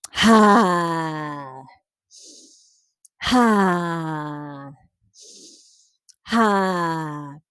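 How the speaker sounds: forceful lion's breath exhales; pulsed ha sounds. Shown as a demonstration. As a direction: push each ha out hard from the belly. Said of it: "So you go".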